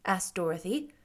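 usual speaking voice

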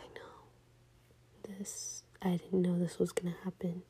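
very soft, almost whispered start; hushed consoling. Retold or imitated. Imitating Chad consoling her at the gas station, hushed. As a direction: say it softly, as to someone crying.

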